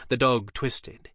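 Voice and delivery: ordinary delivery, nothing marked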